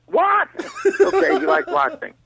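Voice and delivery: high laughing yelp of surprise; shocked laughing shout. Retold or imitated. Caught by the husband, laughing high surprised shout.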